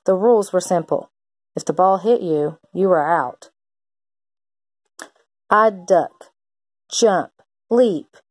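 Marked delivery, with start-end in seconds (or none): none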